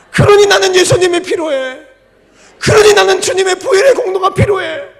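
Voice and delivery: loud, high, shouted preaching; preaching at full force. Acted. Preacher shouting to the congregation, full force. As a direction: drive it home to the congregation.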